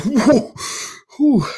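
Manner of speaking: high, startled whoa and whew; mock relief. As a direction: jump at the click, then a relieved whew. Denzel startled at empty gun.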